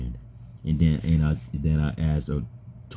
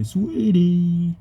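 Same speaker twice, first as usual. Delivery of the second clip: high squeaky mock sweetie; a shrill playful imitation of sweetie; imitating the sweetie voice; 3 dB louder than usual. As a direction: mimic the sugary sweetie way up high. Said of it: "you say".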